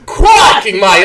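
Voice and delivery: put-on loud, hammy Australian accent; mock-Australian verdict, punched opening. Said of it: "butchering of an Australian".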